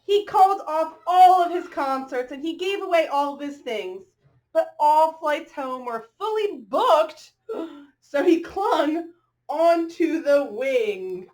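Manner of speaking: bright, rhythmic picture-book read-aloud; reading to children. A narrator's reading. Reading a rhyming dog story to children, lilting storyteller voice. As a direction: read the rhyme to the little ones.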